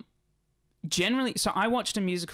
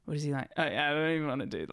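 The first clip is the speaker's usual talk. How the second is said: usual speaking voice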